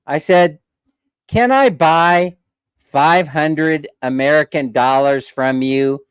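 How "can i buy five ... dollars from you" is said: asking the wife slowly so the regulator hears; slow, deliberate ask. Retold or imitated. Measured, pointed request, slowed right down. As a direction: spell out each word of the offer.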